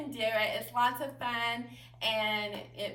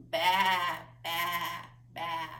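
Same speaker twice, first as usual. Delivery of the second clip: lamb baa; 5 semitones lower, much slower than usual. Shown as a demonstration. Teacher showing children a lamb's baa, bleating. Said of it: "your animal sounds".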